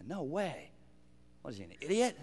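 soft, low muttered scoff; scoffing crowd. Retold or imitated. Doubter in the crowd mocking Caleb, scoffing. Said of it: "in the crowd with a stone".

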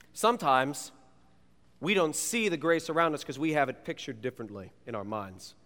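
normal talking voice

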